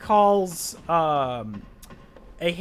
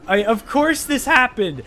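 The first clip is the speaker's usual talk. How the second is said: livid; 5 semitones higher, 5 dB louder than usual. Real, not acted. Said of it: "I was so livid".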